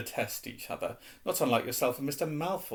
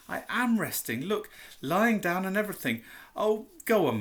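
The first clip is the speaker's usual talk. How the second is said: put-on young boy pleading; 6 semitones higher than usual. Harry pleading.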